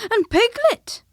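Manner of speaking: high, loud happy exclamation; a boy excitedly naming another friend. Acted. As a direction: shout it out with delight. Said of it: "said Christopher Robin excitedly".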